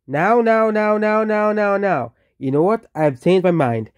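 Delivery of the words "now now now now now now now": high, insistent chant of now; frantic repeated now. Acted. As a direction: demand it over and over, pitched up.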